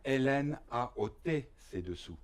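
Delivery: normal talking voice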